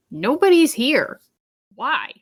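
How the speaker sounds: usual speaking voice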